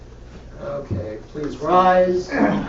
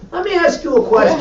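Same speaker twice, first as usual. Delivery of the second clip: put-on New York accent; 10 semitones higher, 8 dB louder, faster than usual. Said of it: "I love about New Yorkers".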